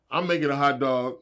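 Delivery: usual speaking voice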